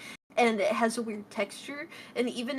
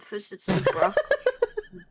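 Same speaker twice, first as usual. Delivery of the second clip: laughing through words; 6 semitones higher than usual. Real, not acted. Laughing while listing vegetables.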